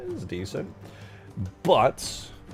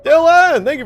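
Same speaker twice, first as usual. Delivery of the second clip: excited shout-out; 13 dB louder than usual. Real, not acted. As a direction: holler the new member's name in celebration. Calls out a new member's name.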